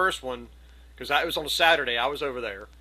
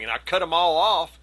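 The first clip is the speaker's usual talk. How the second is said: normal talking voice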